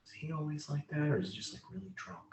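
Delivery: softly muttered gossip; hushed aside. Acted. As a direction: say it under your breath. Astronomer whispers to colleague.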